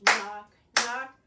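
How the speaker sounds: loud punched knock, knock; demonstrated knocks. Shown as a demonstration. Teacher shows the children the knocking motion, loud. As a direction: knock out each word loudly.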